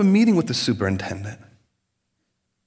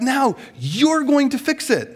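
much higher, slow pointed command; mock demand; 10 semitones higher, slower than usual. Voters tell their candidate, higher and slow. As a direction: hand over the job pointedly.

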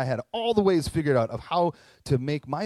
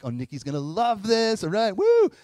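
loud, high, cheering himself on; excited self-cheer; 7 semitones higher, 7 dB louder than usual. Retold or imitated. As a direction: hype himself up with a big woo. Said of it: "I think".

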